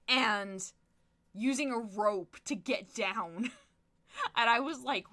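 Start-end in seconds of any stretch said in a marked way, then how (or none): none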